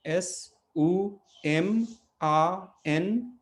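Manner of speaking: एस उ एम आ एन spelled slowly letter by letter; spelling out letters. Shown as a demonstration. Teacher spelling a name to students, slowly. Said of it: "तो जैसे सुमन भट्ट को हम कैसे पढ़ेंगे?".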